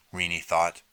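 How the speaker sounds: usual speaking voice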